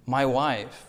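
ordinary delivery

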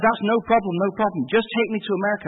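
high-pitched, eager imitation; insistent nurse's voice. Nurse insisting patient take her along, eager imitation.

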